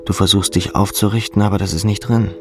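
ordinary delivery, nothing marked

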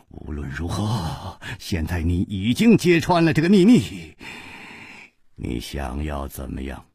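低声开口，中间扬起，再沉下去; 压着嗓子冷静反问; 沉着摊牌的无花. Acted. Wuhua confronts Chu quietly. (低沉轻缓地摊牌)

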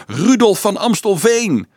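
high-pitched, ecstatic crowd cheer; frenzied yell. A narrator's reading. Children hail Dolf as their helper, frenzied yell. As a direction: cheer the hero's name wildly. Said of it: "gilden ze uitzinnig".

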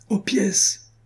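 high-pitched call to the guns, no extra volume; ringing cry. A narrator's reading. Armand Louis orders soldiers to the cannons, ringing cry. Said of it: "cria la voix retentissante d'Armand Louis".

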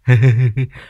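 loud, unhinged chuckle; hysterical laugh. A narrator's reading. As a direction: burst of crazed cackling. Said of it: "nhoẻn miệng cười sằng sặc".

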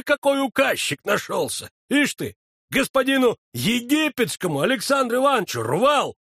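annoyed scolding medic; irritated bossing about; angry feldsher. A narrator's reading. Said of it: "сердится фельдшер". (сердито)

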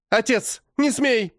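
she shouts at her father to stop; angry woman's cry. A narrator's reading. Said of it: "раздаётся рассерженный женский голос". (рассерженный женский крик)

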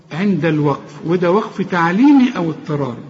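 ordinary talk

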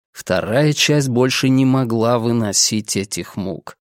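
ordinary delivery

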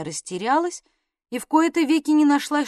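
usual speaking voice

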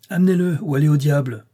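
defiant demand, thrown at the lawyer; angry challenge. A narrator's reading. (défi irrité, plus haut et plus fort)

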